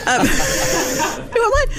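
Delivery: high, flustered giggle through the words; laughing. Real, not acted. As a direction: laugh at your own blank moment. Mayor forgets her lines, laughs.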